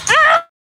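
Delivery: sudden high loud cry; startled yelp. Real, not acted. Owner startled while fetching the goat, high yelp. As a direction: yelp in surprise.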